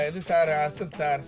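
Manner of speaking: normal talking voice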